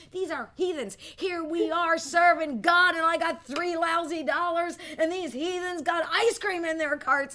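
resentful thoughts. Retold or imitated. Preacher replaying her jealous anger at grocery shoppers, loud and indignant. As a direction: replay the bitter thought at full volume. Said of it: "but I remember thinking".

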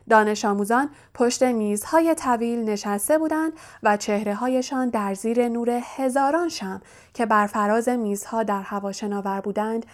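plain reading voice

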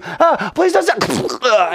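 high, slow hammed-up plea; mock pleading. Villagers in their beds begging not to be stabbed, high mock pleading. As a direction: beg with fake fear.

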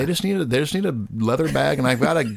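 goofy rural character voice. Pretend. Mocking Tripp's imagined voice.

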